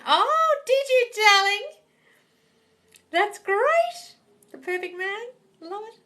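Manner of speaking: high, loud, slowed delight; thrilled for her news; high drawn-out squeal of joy; excited gush. Real, not acted. Reacting to viewer's new man.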